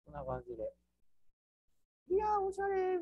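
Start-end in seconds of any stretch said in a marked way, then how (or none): 2.1-3.0 very high, gushing delight; excited client voice. Pretend. Teacher mimics a client admiring the washroom, high squeal. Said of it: "みたいな".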